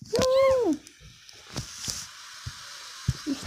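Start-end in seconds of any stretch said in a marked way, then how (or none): 0.1-0.8 high-pitched woo; short high whoop of celebration; cheering woo. Pretend. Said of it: "Let's cheer".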